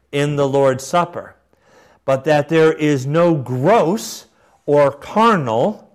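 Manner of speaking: measured, emphatic oratory; formal quotation reading. Acted. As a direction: read it like a solemn proclamation. Reads bishop's words aloud.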